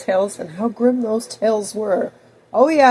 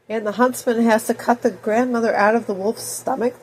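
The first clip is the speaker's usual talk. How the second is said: usual speaking voice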